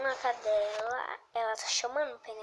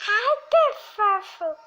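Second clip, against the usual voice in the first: very high, loud, slow coo; cooing squeal; 10 semitones higher, 12 dB louder, slower than usual. Real, not acted. Cooing at the cute item in the game, slow high squeal. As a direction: melt over something cute.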